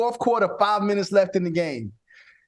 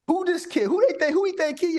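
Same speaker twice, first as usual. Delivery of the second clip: higher, incredulous voicing of the other team; mock disbelief; 9 semitones higher than usual. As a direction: sneer as the rival team would. Mocking opponents' disbelief.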